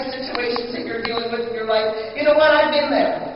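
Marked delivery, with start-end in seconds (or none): 2.2-3.2 rising, louder preacher's cry; preaching. Acted. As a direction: build it up from the pulpit. Preacher builds up the plea.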